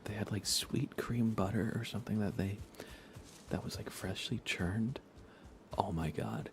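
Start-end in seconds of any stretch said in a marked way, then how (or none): none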